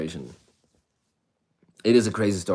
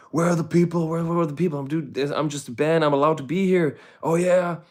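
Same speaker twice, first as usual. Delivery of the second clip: much higher, frantic pleading with the police; a handcuffed musician begging to be believed; panicked pleading; 9 semitones higher, 4 dB louder than usual. Retold or imitated. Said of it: "I'm like".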